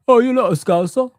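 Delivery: mock accusation in someone else's voice; mocking critic impression. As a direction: mimic a fan's objection, mockingly. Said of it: "Telling me".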